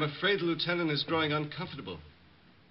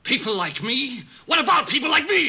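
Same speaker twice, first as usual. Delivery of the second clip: suddenly much louder and higher, outraged; offended flare-up; 9 semitones higher, 7 dB louder than usual. Acted. Bomber flaring up at the detective's slight, loud and outraged. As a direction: explode with wounded pride.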